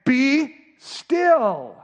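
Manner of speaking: loud, high, drawn-out command; commanding rebuke; correction. Acted. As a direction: order it firmly, holding each word. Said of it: "It's a correction".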